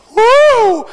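sudden high, loud woo; a preacher whooping with joy; joyful whoop. Real, not acted. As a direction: burst out in praise. Sudden joyful exclamation in sermon.

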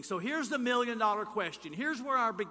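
ordinary talk, nothing marked

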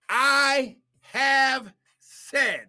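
"I have said" drawn out very slowly, each word weighted; stress on I HAVE SAID. Shown as a demonstration. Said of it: "I want you to pay attention".

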